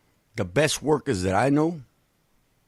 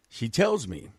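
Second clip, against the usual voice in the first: usual speaking voice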